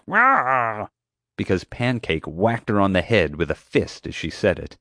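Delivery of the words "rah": a short mock roar struck mid-word; cut-off roar. Guard roaring as she is knocked out, cut-off roar. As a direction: bark one blunt syllable as if hit on the head. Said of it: "It was more like".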